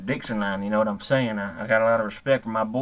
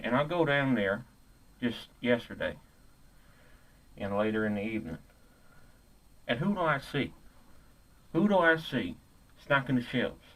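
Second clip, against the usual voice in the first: ordinary talk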